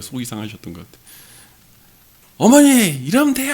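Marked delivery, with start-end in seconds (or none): 2.4-3.5 high, loud angry outburst at his mother; angry shout. Retold or imitated. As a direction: yell it in frustration. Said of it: "화를 내신 거예요".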